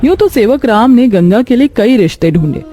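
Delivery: ordinary delivery, nothing marked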